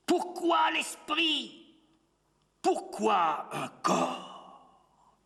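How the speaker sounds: high, drawn-out wail of a question; anguished cry. Acted. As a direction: cry it out slowly, falling away at the end. Anguished questions cried in poem. (cri d'angoisse aigu et étiré)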